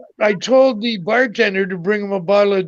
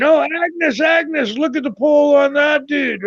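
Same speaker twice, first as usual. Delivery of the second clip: higher-pitched mock women's voices; admiring women; 6 semitones higher than usual. Woman pointing out a caber tosser to Agnes, high-pitched mock admiration. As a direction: call out to Agnes in a high put-on voice. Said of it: "And the women".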